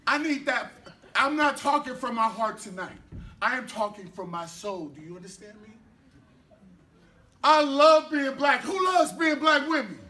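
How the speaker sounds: slow, building spoken-word delivery with loud, high peaks; impassioned spoken word. Acted. Poet calling out to the open-mic crowd, impassioned spoken word. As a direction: preach it to the room like a poet.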